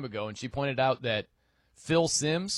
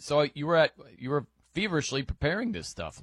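ordinary talk

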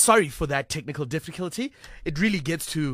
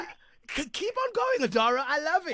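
moved to tears; 8 semitones higher than usual. Real, not acted. Moved by the child's singing.

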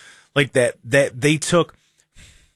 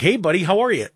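bright, higher cheerful neighbor greeting; friendly Midwest greeting; 8 semitones higher than usual. Retold or imitated.

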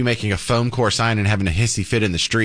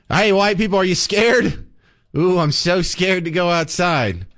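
higher-pitched sarcastic taunt; mock fear; 5 semitones higher than usual. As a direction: play scared to ridicule the threat. Mocking the threat as scary.

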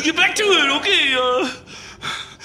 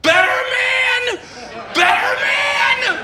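higher, crying shout on the song names; strained tearful shout; 7 semitones higher, much slower than usual. Real, not acted. Heartbroken DJ to listeners, tearful shouted back-announce. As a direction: force the back-announce through tears.

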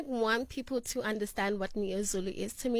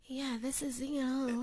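imitating sexy voice; 8 dB quieter than usual. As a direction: copy her sultry way of talking. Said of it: "you see how you sound?".

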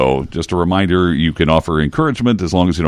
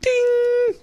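bell ding; 4 dB quieter than usual. Pretend. Game show correct-answer bell.

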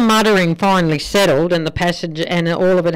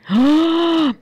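mock gasp; 9 semitones higher than usual. Wife's reaction in joke.

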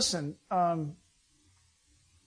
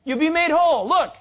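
high, loud, fast call to everyone; calling out to crowd; 9 semitones higher, 6 dB louder, faster than usual. Retold or imitated. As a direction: announce it to the whole crowd. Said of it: "So tell them".

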